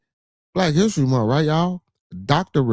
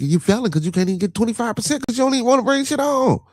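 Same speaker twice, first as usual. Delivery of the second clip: high-pitched exasperated scolding, one long run; dad's high-pitched exasperated scold; 14 semitones higher than usual. Real, not acted. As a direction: pile it all on in one high breath. Scolding son, voice way up.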